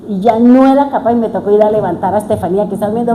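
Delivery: usual speaking voice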